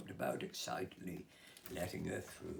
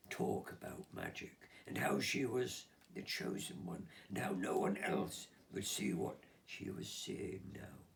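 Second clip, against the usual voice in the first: plain reading voice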